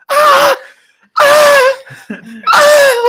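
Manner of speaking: high, loud repeated screams of shock; retold screaming. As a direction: scream out in panic, again and again. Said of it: "like, screaming".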